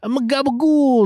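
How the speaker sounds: mock Italian-American accent, pitched high. Pretends to be Italian-American for a stranger, mock Italian-American accent. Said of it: "And I'm like".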